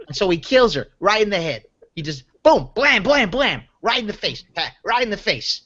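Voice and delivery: Long Island wise-guy storyteller; Joe Pesci. Acted. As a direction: play a touchy, challenging mobster with a Long Island accent. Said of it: "a pretty stellar Joe Pesci thing".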